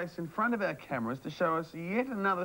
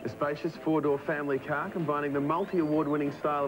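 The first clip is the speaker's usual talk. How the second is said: promo read over music; announcer voice. Acted. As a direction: read the prizes like an ad.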